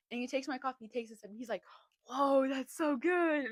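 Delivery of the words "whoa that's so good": loud, drawn-out amazed taster; imitating someone wowed by a sip; impressed reaction. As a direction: play up the delight, slow and loud. Acts his reaction to coffee.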